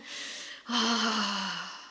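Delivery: long voiced exhale after ranting; slow, settling breath out; calming sigh. Real, not acted. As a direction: let out a long sigh to cool down. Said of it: "I should come".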